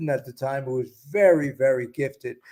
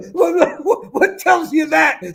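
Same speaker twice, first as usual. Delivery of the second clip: much higher, slow and laughing; high, giggly delivery; 13 semitones higher, 5 dB louder, slower than usual. Acted. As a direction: giggle through the challenge. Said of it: "play my role a little bit differently".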